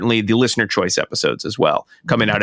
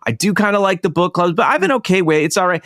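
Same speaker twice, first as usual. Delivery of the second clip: higher, lightly voiced hesitant listener; imagined listener voice; 7 semitones higher than usual. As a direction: play the lukewarm listener thinking aloud. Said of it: "So if you were thinking, like".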